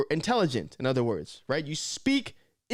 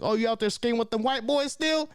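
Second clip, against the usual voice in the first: higher teasing cousin voice; 9 semitones higher than usual. Retold or imitated. Imitating cousins teasing him about skating, higher. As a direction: tease like a cousin. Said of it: "my cousins used to make fun of me".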